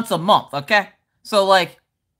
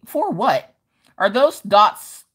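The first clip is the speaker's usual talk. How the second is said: usual speaking voice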